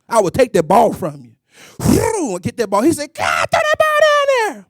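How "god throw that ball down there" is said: yelling coach. Retold or imitated. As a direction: call it down the court.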